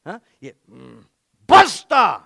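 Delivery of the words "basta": loud, higher Italian Basta; hammed-up Italian shout; Italian accent shout. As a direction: bark it like an angry Italian. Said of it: "maybe he got a little Italian".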